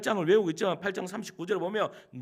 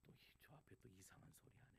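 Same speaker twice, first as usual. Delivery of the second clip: quick skeptical whisper, much softer; muttered aside; 10 semitones lower, 37 dB quieter, much faster than usual. Retold or imitated. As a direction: mimic a listener grumbling under their breath. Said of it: "이렇게 이야기할 수도 있지만". (청중이 속으로 투덜대는 빠른 속삭임)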